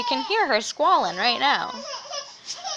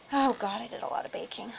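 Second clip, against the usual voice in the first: whispered aside; 7 dB quieter, faster than usual. Real, not acted.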